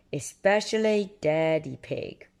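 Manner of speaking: plain reading voice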